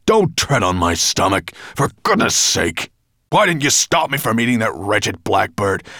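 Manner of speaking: loud dragon bellow, pained and furious; shocking temper. A narrator's reading. Dragon with a stomach ache scolding his servant, bellowing. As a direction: roar at the clumsy servant. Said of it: "He was in a shocking temper".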